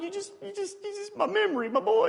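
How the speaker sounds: mock heartbreak. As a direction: whimper like a dad losing a treasure. Dad grieving ruined snow angels.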